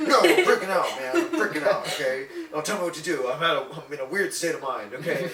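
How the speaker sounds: loud, high-pitched acted panic; retold freak-out. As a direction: push back loudly, acting frantic. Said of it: "Don't freak out".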